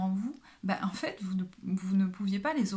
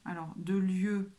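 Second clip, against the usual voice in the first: usual speaking voice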